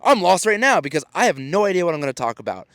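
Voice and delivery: high, bright-voiced mock flamboyant greeting; flamboyant storyteller. Storyteller greeting the audience on stage, playful flamboyant mimicry. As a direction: toss out a showy hello to the crowd. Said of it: "like, flamboyant vibe".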